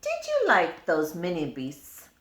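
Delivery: plain reading voice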